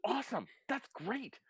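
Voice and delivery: high, delighted little voice; excited, high character voice; thrilled Blackberry. Acted. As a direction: celebrate in a high-pitched squeal.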